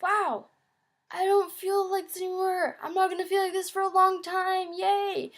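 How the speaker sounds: high, cheerful burst of relief; relieved joy. Retold or imitated. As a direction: celebrate like it's finally over, pitched high. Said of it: "I was like".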